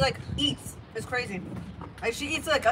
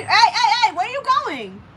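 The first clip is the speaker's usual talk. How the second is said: yelling across the room; 8 semitones higher, 6 dB louder than usual. Real, not acted. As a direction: call out to stop her. Calling after running child.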